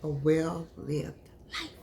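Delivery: tender slow whisper of A well-lived life. Shown as a demonstration. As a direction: breathe A well-lived life softly and slowly. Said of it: "That's what I want for you, baby".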